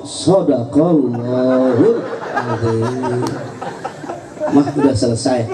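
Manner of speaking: low, very slow mock-pious husband. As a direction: close her rant like ending a sermon, deadpan and low. Husband ends the wife's rant. (suami pura-pura khusyuk, rendah dan pelan)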